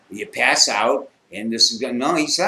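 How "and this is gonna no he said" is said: usual speaking voice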